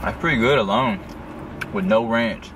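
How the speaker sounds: chewing while talking; talking between bites; mouth full. Real, not acted. Seen 0: Man holding wing, jaw moving between words after biting at -7.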